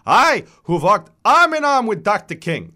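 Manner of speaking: high, loud, indignant self-defence; vigorous accented voice, grandly outraged; reedy old man. A narrator's reading. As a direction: declaim it as an offended old man. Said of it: "His voice is reedy but vigorous".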